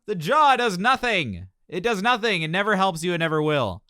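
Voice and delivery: heated and emphatic; passionate rant. Real, not acted. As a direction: hammer the point home. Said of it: "I feel like I sound angry".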